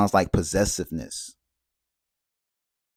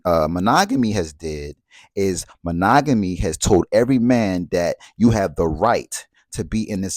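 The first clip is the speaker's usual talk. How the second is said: ordinary delivery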